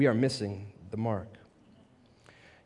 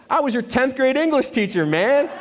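higher, louder delighted reveal; excited recognition; 11 semitones higher, 5 dB louder than usual. Retold or imitated. Teacher telling a former student who he is, excited. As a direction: burst out with glad recognition.

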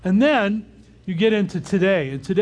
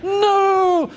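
imitated shrieked no, much higher and louder; toddler refusing; 9 dB louder than usual. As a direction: shriek it like a defiant two-year-old. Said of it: "And she goes".

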